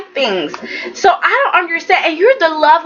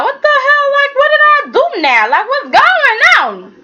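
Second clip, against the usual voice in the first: much higher, fast, baffled squeal; mock bewildered outrage; 12 semitones higher than usual. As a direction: play it like he's suddenly drenched and confused. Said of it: "Luca's just like".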